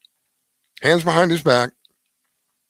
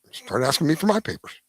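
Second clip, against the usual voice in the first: ordinary delivery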